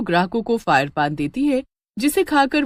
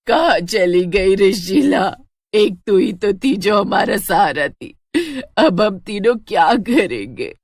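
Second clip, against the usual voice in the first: slow, louder lament; wailing grief; 4 dB louder than usual. Acted. Grandmother wailing over Sheila's death, slow and louder. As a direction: cry out to the dead daughter-in-law.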